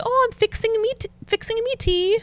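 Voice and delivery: high-pitched English mum; lilting imitation of an English mother; imitating mother-in-law. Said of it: "So she'll say".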